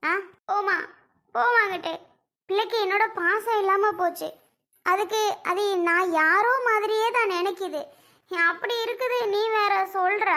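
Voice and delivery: crying complaint, pitched high; tearful wailing. Acted. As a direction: lament your child's lost affection through tears. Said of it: "அதுக்கு ஏண்டி இப்படி அழுகுற?". (அழுதுகொண்டே உயர்ந்த குரலில் புலம்பல்)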